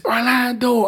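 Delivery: wife shouting to wake her husband, mild mock yell; retold scream. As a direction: call the name like waking someone. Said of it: "screaming in my face".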